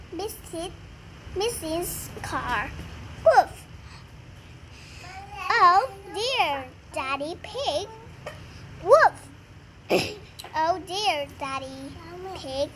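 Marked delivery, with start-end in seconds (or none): 3.2-3.6 loud playful woof; excited dog woof; dog bark. Acted. Child reads dog barking. 8.8-9.3 loud playful woof; excited dog woof; dog bark. Acted. Child reads dog barking.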